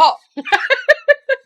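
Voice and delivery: high burst of disbelieving laughter; shrieking laugh. Real, not acted. She recalls a bare foot at her head, shrieking laugh. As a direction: laugh out loud at the memory.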